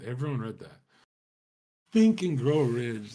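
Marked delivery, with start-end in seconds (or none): 1.9-3.1 slow, louder, dismissive mock of the title; mocking repeat. Shown as a demonstration. Scoffing at a book everyone read, slow and mocking. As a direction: drag the title out with scorn.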